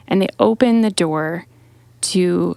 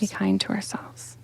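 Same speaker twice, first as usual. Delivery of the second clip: whisper; 10 dB quieter than usual. Real, not acted. Echoing the gentle reminder.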